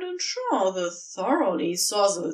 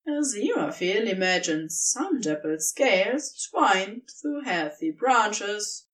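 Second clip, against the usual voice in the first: plain reading voice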